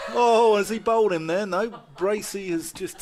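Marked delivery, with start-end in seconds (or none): none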